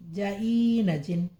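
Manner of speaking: slow, separated syllables joined into जिन; teacher sounding out जा ई न, then जिन; slow syllable blending. Shown as a demonstration. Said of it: "जीम नून जेर जिन". (धीरे-धीरे अक्षर जोड़कर पढ़ाना)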